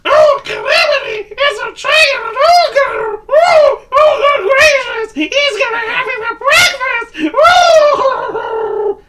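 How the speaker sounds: high shrieking squeal in panic; screaming squeaky voice. Acted. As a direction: scream it out, terrified. Mister Nervous panicking.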